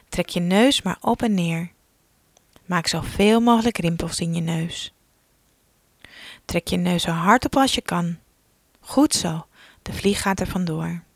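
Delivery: ordinary talk, nothing marked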